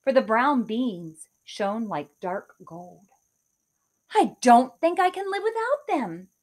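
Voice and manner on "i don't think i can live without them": eager. Acted. Kate longing for beans.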